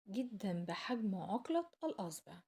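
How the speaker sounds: ordinary delivery, nothing marked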